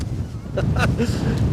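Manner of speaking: high airy giggle; breathy laugh. Real, not acted. Host laughing at himself again, airy giggle.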